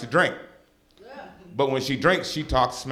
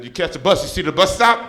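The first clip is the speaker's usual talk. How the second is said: mom's punchline; 4 semitones higher, 7 dB louder than usual. Retold or imitated. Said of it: "She said".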